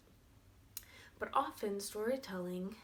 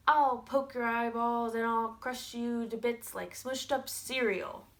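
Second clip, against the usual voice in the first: big goat's loud, raised threat; loud, mean goat; 4 semitones higher, 8 dB louder than usual. Acted. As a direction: threaten the troll with full storybook bluster. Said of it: "louder and meaner than the troll's voice".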